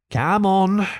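high, drawn-out call, mildly grim. A narrator's reading. Rat setting off with Mole, mildly grim. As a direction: urge on with a touch of grimness. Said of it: "he said grimly to the mole".

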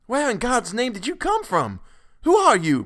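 high, loud outburst; frantic. A narrator's reading. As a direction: demand answers in panic. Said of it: "burst out Mister Button frantically".

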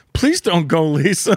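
retold sad plea. Saddened listener begging a comic not to quit, playful plea. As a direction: plead lightly, voice lifted, half-playing the saddened fan. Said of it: "I was genuinely saddened".

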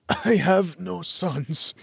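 very slow, strained dying voice; dying man. A narrator's reading. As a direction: force out each word. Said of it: "a sudden fit of coughing".

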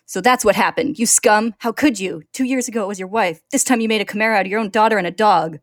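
loud, raging accusation; full furious shout. A narrator's reading. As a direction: scream it in his face. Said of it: "absolutely furious".

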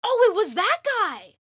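loud, high burst of recognition; surprised outburst. Real, not acted. Podcast host suddenly recognizing a lawyer's name, surprised shout. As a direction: blurt it out high and loud, truly astonished.

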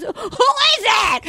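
high, hammed-up shriek; mock frantic eagerness. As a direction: burst out like an overeager mom. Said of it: "Barb is crawling out of her skin".

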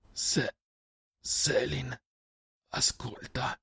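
faint, halting dying whisper; failing whisper. A narrator's reading. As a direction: whisper weakly, breaking up the words. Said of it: "la voce ridotta a un sussurro".

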